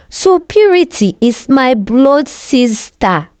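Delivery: usual speaking voice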